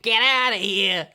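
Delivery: loud, drawn-out mock Boston dismissal; mock Boston accent. Sub shop worker hustling customers out, mock Boston accent. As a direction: order them out in a hammed-up Boston accent. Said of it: "why I would use that accent".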